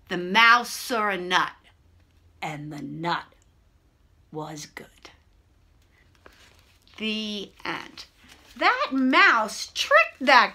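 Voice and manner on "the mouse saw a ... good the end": low storytelling fading to near-whisper; softly closing a bedtime tale; quiet ending. A narrator's reading. Tale settles to a quiet end.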